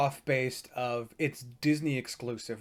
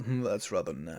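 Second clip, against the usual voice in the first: low, softer villain aside after the belting; villain song aside; 5 semitones lower, 6 dB quieter than usual. Retold or imitated. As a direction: drop down into a purring mock-villain aside. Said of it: "I love the villain song".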